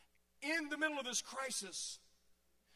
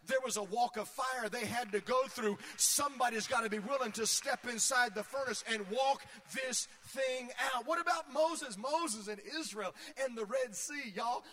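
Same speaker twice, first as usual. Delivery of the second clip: preaching at full force; 5 dB louder than usual. Acted. Preacher's climax, voice rising.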